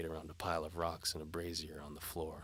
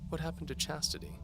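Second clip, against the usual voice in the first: seductive noblewoman; 12 semitones higher than usual. A narrator's reading. Antimony dares the knight to follow. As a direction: tease the knight like a bold noblewoman.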